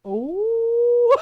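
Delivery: long rising ooh, high and loud; teasing ooh. Retold or imitated. Shopper spotting the clerk's crush, teasing ooh. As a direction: tease someone about a crush with a swooping ooh.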